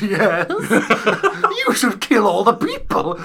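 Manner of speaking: laughing, sly villain voice; lightly hammed-up scheming advisor; evil advisor. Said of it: "And the evil advisor goes".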